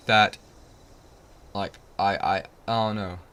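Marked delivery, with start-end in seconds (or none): none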